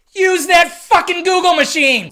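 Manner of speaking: loud, exasperated rant at complaining fans; ranting rage. Real, not acted. Fed-up vlogger yelling at griping fans, loud ranting rage. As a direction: let the rage boil over, loud. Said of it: "I get a little rage".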